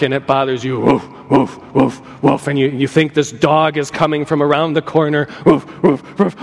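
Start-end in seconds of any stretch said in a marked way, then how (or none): 0.8-2.5 low, menacing dog woof; low imitated dog barking; deep bark. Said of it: "a deep, strong bark". 5.4-6.4 deep bark. Retold or imitated. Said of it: "a deep, strong bark".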